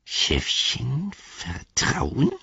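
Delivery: high doubtful creature voice; skeptical Gollum. A narrator's reading. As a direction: question it warily, creature-like. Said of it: "sagte Gollum skeptisch". (skeptische Gollum-Stimme)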